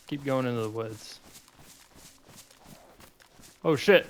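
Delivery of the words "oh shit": sudden loud curse; startled shout. Real, not acted. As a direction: blurt it out in shock. Startled by wolf attack.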